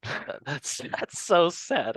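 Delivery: slow words broken by laughter; real laughter on "that's so sad"; laughing. Real, not acted. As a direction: laugh through the pity. Laughing at the benchwarmer joke.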